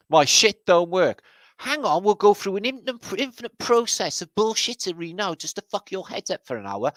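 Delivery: high-pitched, rising mock runaround; mocking call-centre spiel. Call-centre agent stringing the caller along, high mocking spiel. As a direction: rant it as a hammed-up phone agent.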